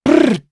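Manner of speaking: a high, loud rattling brrr like gunfire; machine gun burst. Retold or imitated. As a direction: mimic a machine pistol spraying rounds. Imitating machine pistol fire.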